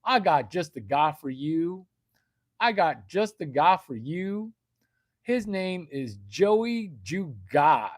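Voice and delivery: put-on Yankee putting on a Southern accent; phony Southern accent with swooping vowels; fake Southern accent. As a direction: stretch it into a mock Southern salesman twang. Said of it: "every Yankee has got a Southern fucking accent".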